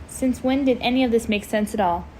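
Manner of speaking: usual speaking voice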